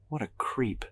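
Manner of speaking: soft, scornful mutter. A narrator's reading. Man eyeing a disliked acquaintance, muttering. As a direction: mutter the insult half to yourself. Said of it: "I mutter".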